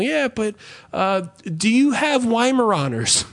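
high, chirpy put-on customer voice; mock customer. The customer asks about a dog breed.

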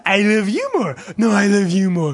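giggling lovey-dovey voice; giggly late night call. Retold or imitated. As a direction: coo it like a smitten couple. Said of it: "You two have, like".

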